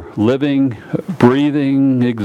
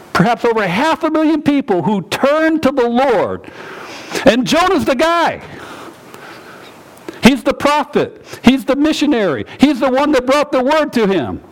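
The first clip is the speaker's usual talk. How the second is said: preaching at full force; 12 semitones higher than usual. Acted. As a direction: drive it home to the congregation. Preacher building to climax.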